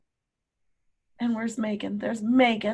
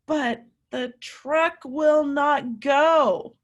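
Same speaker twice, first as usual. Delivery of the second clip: "truck will not go" shouted slowly, each word hit; slow dramatic shout; 5 semitones higher, 4 dB louder, much slower than usual. Shown as a demonstration. Teacher leading children in chorus, slow loud chant. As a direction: hammer out "will not go" for the kids to join. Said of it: "Say it with me".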